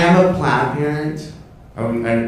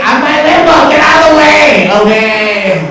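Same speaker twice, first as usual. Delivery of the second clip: high, loud honking voice; car horn speaking; 14 semitones higher, 10 dB louder than usual. Retold or imitated. His car honking at other drivers, high and loud. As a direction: blare it like a horn talking. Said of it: "my car speaking Spanish".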